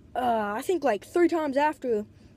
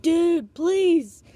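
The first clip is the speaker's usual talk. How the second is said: louder, higher exasperated begging; pleading; 5 semitones higher, 6 dB louder than usual. Retold or imitated. Brother begging sister to let him stay, loud high plea. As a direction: beg hard, punching both words.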